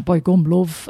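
a quick broad Yorkshire accent. Shown as a demonstration. Showing what her mother doesn't sound like, a Yorkshire accent. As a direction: toss off a broad northern English phrase. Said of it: "rather than a".

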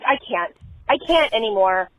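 normal talking voice

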